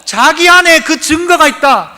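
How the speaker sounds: high, loud preaching, slowed on the hymn line; fervent preaching. Acted. Pastor preaching fervently to the congregation. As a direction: preach it out with full force.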